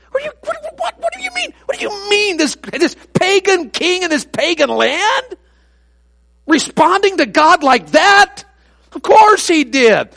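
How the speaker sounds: high, loud pulpit cry, slow at times; full-force preaching. Acted. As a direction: preach it out to the back pew.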